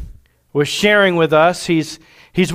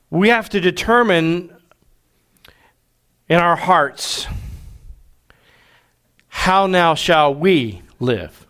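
slow, weighty preaching with long pauses; sermon delivery. Acted. Pastor preaching to the congregation, solemn. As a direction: lay it down like a pastor.

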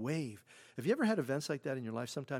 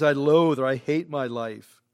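anguished outcry; 11 dB louder than usual. Acted. Job voiced, despairing outcry.

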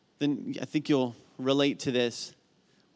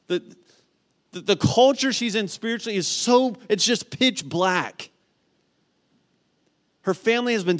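preaching at full force; 9 semitones higher, 5 dB louder than usual. Acted. Pastor describing Naomi's despair to congregation, intense preaching. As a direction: hammer each line home.

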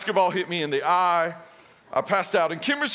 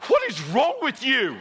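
higher, incredulous nurse scolding; exasperated nurse; 6 semitones higher than usual. Retold or imitated. Nurse to the fainting husband, an incredulous higher scold. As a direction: demand it with disbelief. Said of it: "and she says".

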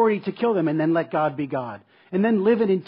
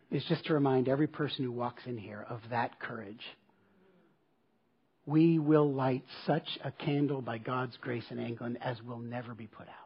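solemn hush; 5 semitones lower, 8 dB quieter than usual. Retold or imitated. Solemn quote, fading hush.

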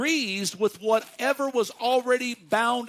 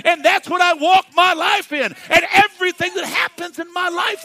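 building to a shouted climax; rising, louder preaching crescendo; 5 semitones higher, 7 dB louder than usual. Acted.

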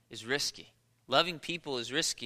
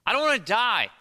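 higher, louder comic protest; emphatic protest; 5 semitones higher, 5 dB louder than usual. Acted. Preacher answers why we fear flying, emphatic protest. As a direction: blurt it out as a mock-fearful protest.

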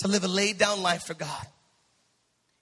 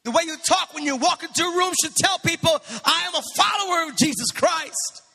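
high-pitched, driving sermon voice; rising preacher's fervor; impassioned preaching; 7 semitones higher, 4 dB louder than usual. Real, not acted. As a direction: drive the point home to the congregation. Preaches at full force.

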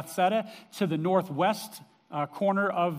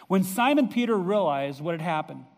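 ordinary talk, nothing marked

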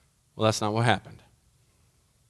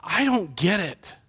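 raised, urgent questions to God; pleading prayer; 7 semitones higher, 3 dB louder than usual. Retold or imitated. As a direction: plead with God, pressing each question higher. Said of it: "and I was like".

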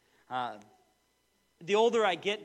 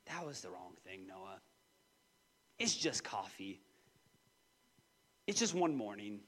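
1 gentle rebuke; 9 semitones lower, 7 dB quieter than usual. Retold or imitated. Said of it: "the Lord just be like".

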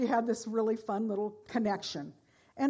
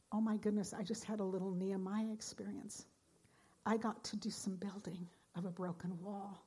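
soft hushed whisper of wonder; awed inner thought; 2 semitones lower, 10 dB quieter than usual. Retold or imitated. Preacher recalling her inner thought, hushed whisper. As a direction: breathe it like a private thought.

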